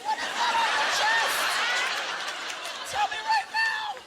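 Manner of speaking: very high, loud mock shriek; shocked shriek. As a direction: screech it in horror. Shocked at fake breasts.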